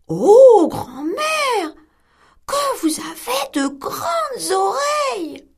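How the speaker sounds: put-on girl staring at granny in bed; wondering little girl. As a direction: marvel like a puzzled little girl. Girl astonished.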